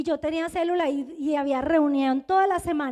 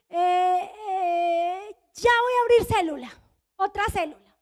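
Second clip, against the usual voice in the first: drawn-out hesitation, then a louder, higher blurt; stalling bluff; 3 semitones higher, 4 dB louder than usual. Retold or imitated. Member put on the spot at the meeting, stalling then bluffing. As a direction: stall on long fillers, then blurt the answer.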